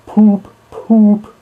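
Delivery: high, loud honking poop poop; wailing car horn. Acted. Motor car horn wails behind Rat and Toad, high honking. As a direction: wail it like a distant motor horn. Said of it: "wailed like an uneasy animal in pain".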